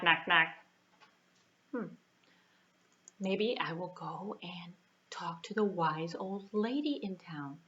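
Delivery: usual speaking voice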